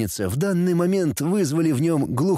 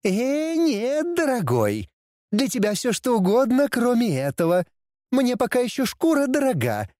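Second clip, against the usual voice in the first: high mocking she-demon; sassy demoness voice; 11 semitones higher than usual. A narrator's reading. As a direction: scoff at him like a cheeky demoness.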